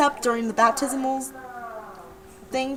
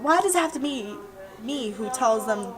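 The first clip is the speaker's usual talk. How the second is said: ordinary talk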